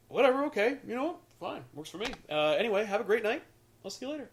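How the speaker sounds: high-pitched brush-off, fading in volume; mock nonchalance. Rejected man saying goodnight to his date, mock nonchalance. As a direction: act like you don't care.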